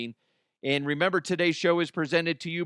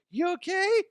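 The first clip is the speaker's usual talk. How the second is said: put-on much higher child voice, sleepy and sweet; little daughter. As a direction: play the small girl asking. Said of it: "She's like".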